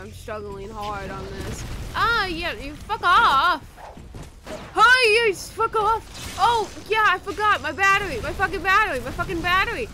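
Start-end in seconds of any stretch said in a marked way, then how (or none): 2.0-6.3 loud, high shout of "fuck off"; furious screaming at a game enemy; yelling at an enemy. Real, not acted. Attacked by enemy, yelling back. 6.4-9.9 loud, high exasperated yell; loud panicked cursing over the battery; frantic yelling. Real, not acted. Frantic about forgotten battery.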